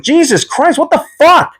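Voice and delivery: high, strained yelp of shock; retold pained shock. Patient reacting to nurse yanking his IV, high pained yelp. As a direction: recreate the jolt of the IV ripped out.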